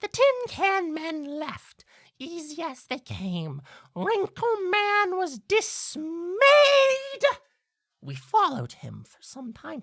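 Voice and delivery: high, slow bird voice; talking blackbird. Acted. Blackbird telling the player about that night, high and slow.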